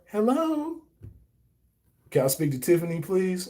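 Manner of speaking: put-on high, sweet old grandma on the phone; sweet old lady voice. Grandma answering the phone to a kid, sweet old lady voice.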